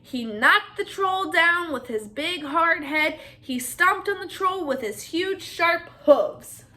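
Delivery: plain reading voice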